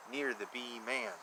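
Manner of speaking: ordinary delivery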